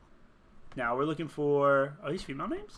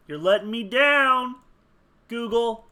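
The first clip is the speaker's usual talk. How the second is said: loud, high playful complaint; mock scolding; 7 semitones higher, 10 dB louder than usual. Player scolding Google for failing, mock complaint. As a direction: raise your voice in pretend disappointment.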